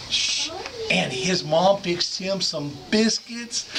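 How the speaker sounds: normal talking voice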